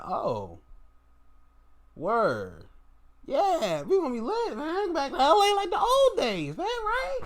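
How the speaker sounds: high playful put-on voice, hyped; imitating Russell.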